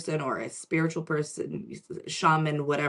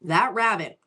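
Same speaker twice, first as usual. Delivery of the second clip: ordinary delivery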